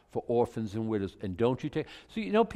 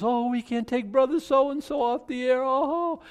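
much higher whiny pleading; mock whining preacher; 13 semitones higher than usual. Mocking preachers who beg widows, much higher whine. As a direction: whine like a desperate TV evangelist. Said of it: "People have done this from the pulpit".

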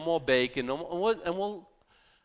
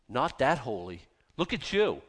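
normal talking voice

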